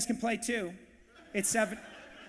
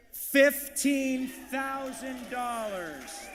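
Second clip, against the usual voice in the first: very slow, drawn-out, higher auctioneer call; big bid announcement; 4 semitones higher, much slower than usual. Real, not acted. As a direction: stretch every syllable out to the room.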